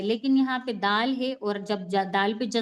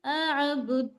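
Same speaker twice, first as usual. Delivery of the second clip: drawn-out recitation; 3 semitones higher, much slower than usual. Shown as a demonstration. Teacher chanting one word for repetition. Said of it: "वला".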